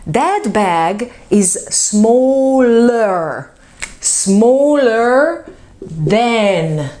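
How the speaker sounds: very slow, stretched out; slow stretched demo. Shown as a demonstration. Teacher modelling comparison, very slow. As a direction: draw out each word for the class. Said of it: "as I wrote it here".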